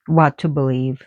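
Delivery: usual speaking voice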